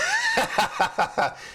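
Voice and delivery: bursts out in a big laugh; hearty laugh. Real, not acted.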